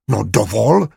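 indignant outburst in an altered voice; mild narrated bellow of offence; angry roar. A narrator's reading. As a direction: protest in outrage, understated for a roar. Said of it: "zařval a praštil lžící o stůl".

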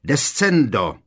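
loud, high cry of command; forceful incantation; shouted spell. A narrator's reading. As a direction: shout it like a spell being cast. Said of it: "a vykřikl".

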